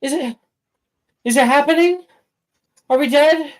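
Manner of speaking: high, alarmed questions; startled awake in panic; panicked. Acted. Panic at the bells.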